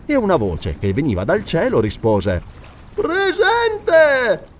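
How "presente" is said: scholar's voice. Acted. Scholar answers from the tree.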